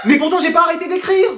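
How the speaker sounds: high-pitched, baffled protest; indignant disbelief. Acted. Pupil learns he got zero, indignant protest.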